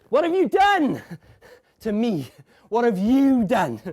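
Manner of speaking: high, loud, despairing cry; anguished shout. Acted. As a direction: hurl the question in horror. Anguished outburst at the scene.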